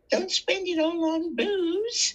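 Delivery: put-on high, slow little-old-lady voice; sweet old lady. Old lady handing the panhandler fifty cents, high and slow. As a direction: squeak it out like a kindly old lady scolding. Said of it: "Ladies came by".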